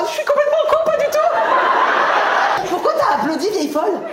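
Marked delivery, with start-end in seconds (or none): 0.1-2.7 high-pitched mock self-reproach; acting out a quote. Acting out a reaction after she threw herself on him, high mock voice. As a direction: put on a high, drawn-out voice.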